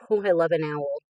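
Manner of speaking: normal talking voice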